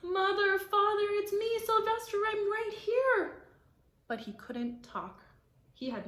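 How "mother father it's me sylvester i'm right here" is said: high, loud desperate call; shouting. A narrator's reading. Sylvester shouting to his mother and father, desperate. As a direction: cry out to his parents. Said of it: "How he wanted to shout".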